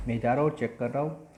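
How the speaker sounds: ordinary talk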